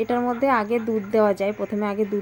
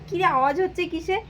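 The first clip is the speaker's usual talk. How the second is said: retold mother's call; 9 semitones higher than usual. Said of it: "আমি বলি".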